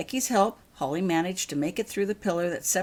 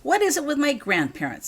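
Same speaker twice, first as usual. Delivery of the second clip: a burst of frustration, higher and louder; frustrated; 10 semitones higher, 5 dB louder than usual. A narrator's reading. Girl venting to her guardian about her grandparents, frustrated. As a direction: let the frustration out in a rising complaint. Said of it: "said Holly in frustration".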